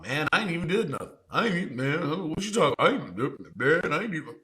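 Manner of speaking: fast, rambling mock protest of innocence; lightly voiced suspect stammering excuses at speed; mock arrested suspect. Man on body cam pleading innocence, fast mock ramble. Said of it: "talking about".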